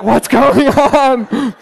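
high, drawn-out baffled wail; hammed-up, slow, high confusion; mock bewilderment. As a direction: stretch it out in comic despair. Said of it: "I'm like".

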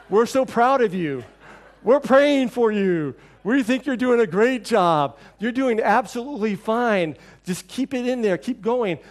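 slow, beaming guests who never heard him; gushing praise. Retold or imitated. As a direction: pour on the praise like an eager guest.

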